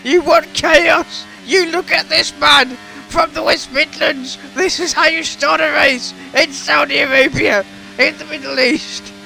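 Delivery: high-pitched, excited race-commentary yelling; real excited hollering, pushed till the voice gives; voice-straining excitement. Real, not acted. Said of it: "my voice is going already".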